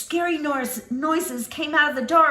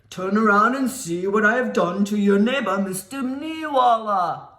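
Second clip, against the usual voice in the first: monster voice; 6 semitones lower, 4 dB louder than usual. Acted.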